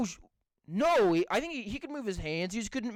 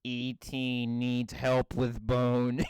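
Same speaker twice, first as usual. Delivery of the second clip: robot voice; 8 semitones lower, much slower than usual. Retold or imitated. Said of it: "Stephen Hawking now".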